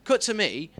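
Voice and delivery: normal talking voice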